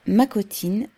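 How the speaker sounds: ordinary delivery, nothing marked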